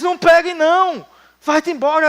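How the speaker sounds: high-voiced aunt pleading with the boy; a worried aunt warning the boy, in a high voice; aunt's warning. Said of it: "Eu disse".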